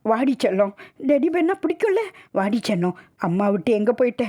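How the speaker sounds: put-on high, tearful pleading with a dead child; choked wailing. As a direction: cry out to her, coaxing through sobs. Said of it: "நெஞ்சை அடைக்க கதறிக்கொண்டே சரிந்தாள்". (நெஞ்சை அடைக்கக் கதறுதல்)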